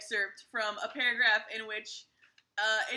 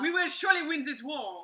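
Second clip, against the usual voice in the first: put-on convincing comic French accent; higher-pitched French-accented boast; mock French accent; 4 semitones higher than usual. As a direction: play a confident French inventor with the accent. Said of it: "a much better French accent".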